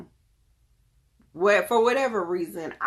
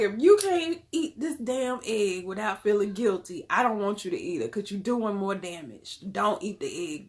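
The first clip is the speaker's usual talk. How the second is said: mock stern mama; 3 semitones higher than usual. Mother retelling firm talk to son.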